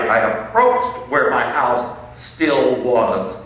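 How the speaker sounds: normal talking voice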